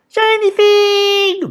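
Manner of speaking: very high, slow goblin cry; drawn-out greedy goblin squeal; eager goblins. A narrator's reading. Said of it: "the goblins cried eagerly".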